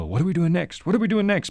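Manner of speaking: high, panicky scramble; mock panic. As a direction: play frantic hosts in a rush. Imitating scrambling hosts panicking.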